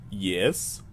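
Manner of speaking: low, put-on Russian accent; faux Russian accent. Clerk raising an eyebrow, low faux Russian accent. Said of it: "a, faux Russian accent".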